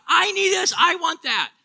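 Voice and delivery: put-on high, pushy little-kid whine; a hint of a demanding child, pitched high. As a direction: play a four-year-old wanting everything. Child crying out for things.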